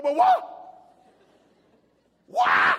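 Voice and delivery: explosive, high-pitched shout; huge emphasis. Shown as a demonstration. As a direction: whoop it with full alarm. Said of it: "can't get the emphasis out of W O E".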